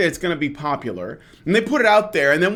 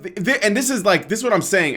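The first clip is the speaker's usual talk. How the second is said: ordinary talk, nothing marked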